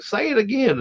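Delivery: high, pressing ask to repeat; urgent request. Retold or imitated. Father to mumbling daughter, urgent high request. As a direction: pitch it up, needing to hear it.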